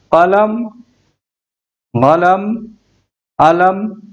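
कलम with a hard k, गलम with a g, अलम with no first consonant; three regional variants. Shown as a demonstration. Teacher showing how countries say the word for pen. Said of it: "गलम भी बोलते हैं".

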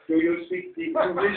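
high mock-patronising question; mock condescension. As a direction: talk down to him, high and teasing. Mock condescension to audience member.

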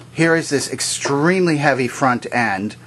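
ordinary talk, nothing marked

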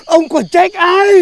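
slow solemn accusation. Acted. Continued mock-solemn accusation.

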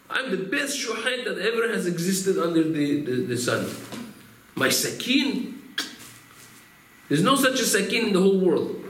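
mock-outraged boast of greatness; boastful denial. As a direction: puff up like an offended braggart. Boastful shochet denies any wrongdoing.

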